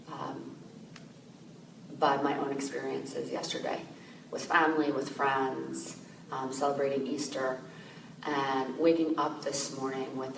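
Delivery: calm, softened voice with long pauses; guided meditation. Real, not acted. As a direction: gently guide listeners inward, leaving space to breathe.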